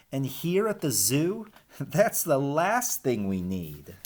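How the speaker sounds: lively storybook read-aloud; animated picture-book reading; reading to children. Acted. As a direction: read the rhymes to the class with bounce. Teacher reads rhyming picture book.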